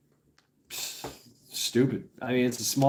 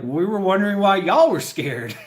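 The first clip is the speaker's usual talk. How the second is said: laughing reply; 6 semitones higher, 5 dB louder than usual. Retold or imitated. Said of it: "we were like".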